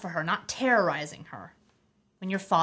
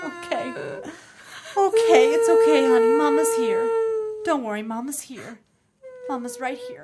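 relieved crying; 8 semitones higher, 4 dB louder than usual. Acted.

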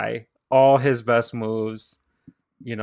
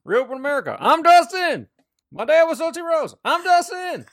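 much higher, hammed-up Dustin sign-off; mock Dustin voice; 5 dB louder than usual. Podcaster parodying Dustin's posts, high hammed-up voice. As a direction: squeak out each line like a parody of Dustin. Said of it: "every sentence is just like".

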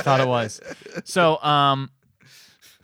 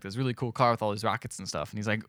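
ordinary delivery

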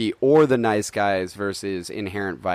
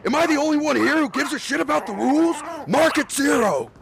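much higher, fast, shouted rant; furious Walter shouting. Acted. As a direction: lose it completely at the lane.

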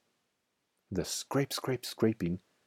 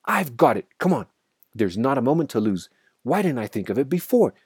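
high, urgent burst of inspiration; raised, hurried storyteller's shout; excited shout; 5 semitones higher, 5 dB louder than usual. A narrator's reading. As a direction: shout it out like a sudden brainwave, rallying everyone. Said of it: "He jumped up and shouted".

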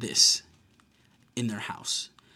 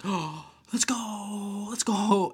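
gasp, then high drawn-out cheer; re-enacted hype, high and elongated; hyped cheering; 8 semitones higher, much slower than usual. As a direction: stretch it out like a fan celebrating. Retold own cheering at the punch.

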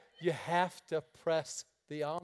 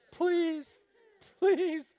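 mock pleading; 11 semitones higher, 5 dB louder than usual. Pleading prayer to God.

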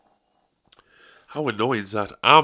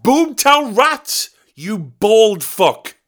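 much higher, louder and slow; furious yell; 12 semitones higher, 6 dB louder, much slower than usual. Retold or imitated. Yelling insults at the bald contestant on TV. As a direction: scream it in exasperation. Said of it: "I'm like".

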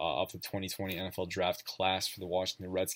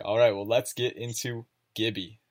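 ordinary talk

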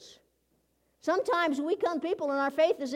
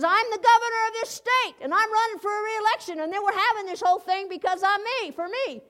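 pompous governor; 6 semitones higher than usual. Retold or imitated. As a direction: puff yourself up as the big man. Governor boasting to lunch lady.

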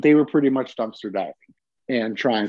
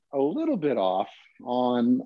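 usual speaking voice

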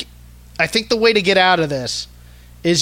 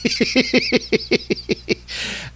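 breathy laugh; 6 semitones higher, 3 dB quieter than usual. Real, not acted. Laughs at the quote.

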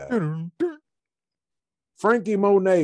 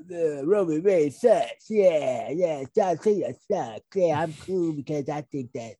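mocking smug fan; 3 semitones higher than usual. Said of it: "people are".

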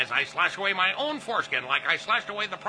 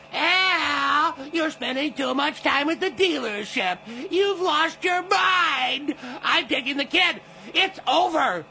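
higher mock nagging wife; imitating wife; 7 semitones higher, 4 dB louder, much slower than usual. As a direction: whine like the angry wife. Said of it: "You wouldn't believe my wife".

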